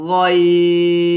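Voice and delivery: drawn-out, heavy throat sound on গইন; heavy Arabic ghain. Shown as a demonstration. Quran teacher showing the class a thick, drawn-out ghain. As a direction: hold গইন long, thick from the back of the throat. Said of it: "বলতে হবে ... মোটা করে".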